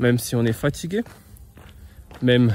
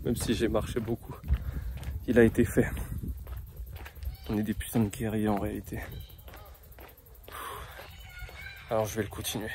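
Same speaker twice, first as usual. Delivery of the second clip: exhausted; 12 dB quieter than usual. Real, not acted. Exhausted runner speaking.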